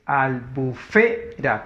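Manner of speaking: albufera slowly split as al-bu-FE-ra; syllable by syllable. Shown as a demonstration. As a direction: sound out albufera with pauses, leaning on FE. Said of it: "Como es grave". (albufera silabeado despacio, con fuerza en FE)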